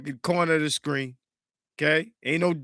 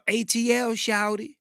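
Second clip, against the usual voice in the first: higher-pitched ATL Southern accent; down-south Atlanta accent; 7 semitones higher than usual. Shown as a demonstration. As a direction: say it like you're from Atlanta.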